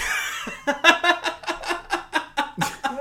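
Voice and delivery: high helpless cackle; real laughing fit; loud laughing fit. Real, not acted. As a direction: burst out laughing at your own punchline. Cracking up at the punchline.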